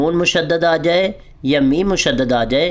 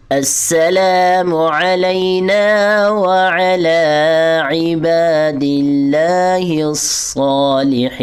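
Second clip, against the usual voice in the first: recite along; 3 semitones higher, much slower than usual. Shown as a demonstration. Teacher leading students to recite along. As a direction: chant it slowly so they can follow. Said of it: "میرے ساتھ پڑھنے کی کوشش کریں".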